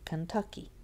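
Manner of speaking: Kentucky with the weight on "tuck"; stress on TUCK. Shown as a demonstration. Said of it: "That has the tonic stress".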